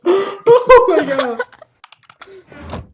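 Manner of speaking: laughing. Real, not acted. Laughing at the dad story.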